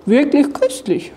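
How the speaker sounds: a monster's pleased verdict; praising. A narrator's reading. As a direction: savour it approvingly. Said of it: "lobte es".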